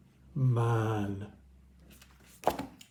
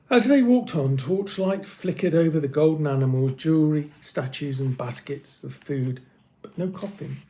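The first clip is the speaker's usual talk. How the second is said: ordinary delivery, nothing marked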